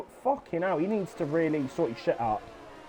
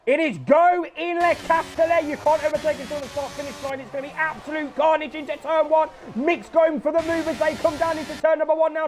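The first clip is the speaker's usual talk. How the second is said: lively live play-by-play; excited sim racing commentator; race commentary; 7 semitones higher than usual. Acted. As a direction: call the race as it happens.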